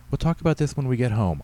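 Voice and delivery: a touch of parental firmness; firm mother. A narrator's reading. Mother closing an argument with her son, mildly firm.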